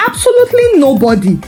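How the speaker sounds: usual speaking voice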